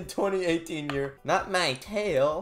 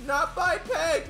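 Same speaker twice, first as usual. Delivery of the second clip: hammed-up villain voice, high and slow; cackling curse, drawn out and theatrical; evil [name]; 8 semitones higher, slower than usual. Evil [name] cursing everyone who rejected her, hammed-up. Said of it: "I am now evil [name]".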